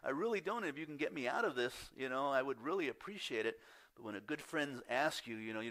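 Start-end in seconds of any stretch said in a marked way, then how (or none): 0.0-3.6 high, pleading, half-joking plea to God; pleading prayer. Retold or imitated. Pastor voicing his own nervous prayer before guest teaching, high pleading. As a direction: beg your way out of it with a grin. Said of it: "I'm honest with God".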